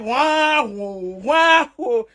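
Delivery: mock baby wail, wah wah; mocking crybaby wail. Mimics crying of critics.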